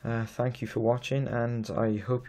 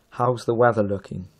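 loud voice command; 9 dB louder than usual. Real, not acted. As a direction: clear loud command to the phone. Voice command to phone.

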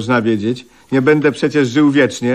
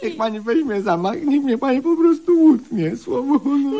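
much higher, squeaky, put-on voice; hammed-up pleading. [name] begging Eliza to chop him, squeaky mock plea. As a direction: plead for the axe in a silly falsetto.

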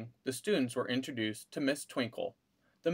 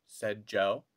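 usual speaking voice

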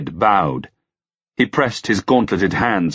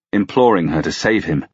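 ordinary delivery

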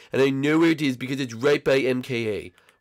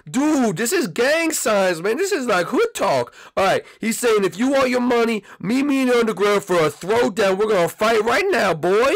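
intense; 9 semitones higher, 3 dB louder than usual. Acted. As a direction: hype up the challenge, pitch raised. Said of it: "that sounds really intense".

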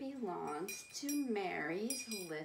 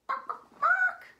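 short clucks, then a loud high squawk; hen clucking; 11 dB louder than usual. Pretend. Host revealing the big fat hen, clucks and squawk. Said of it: "she makes that funny bark".

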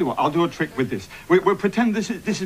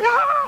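squeaky showman's cry; triumphant high "ah"; 3 dB louder than usual. Acted. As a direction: hit a high flourish. Showy magician's flourish.

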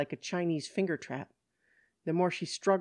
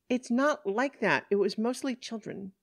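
higher, insistent self-defence; defensive protest; 7 semitones higher than usual. Acted. Woman explaining her party to police, defensive protest. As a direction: protest your innocence, pitched up.

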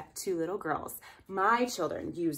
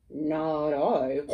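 pig voice; 3 semitones lower, 4 dB louder than usual. Acted. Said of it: "said the pig".

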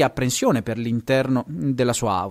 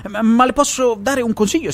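quick, higher-pitched interjection; hurried; 8 semitones higher, 4 dB louder than usual. A narrator's reading. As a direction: rush in before she explodes. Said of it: "aggiunse in fretta". (in fretta, più acuta)

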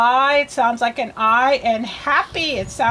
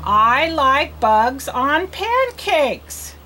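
very slow, word by word, stretched; reading for learners; much slower than usual. Shown as a demonstration. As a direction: read "I like bugs on pancakes" slowly for a beginner. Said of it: "You read it".

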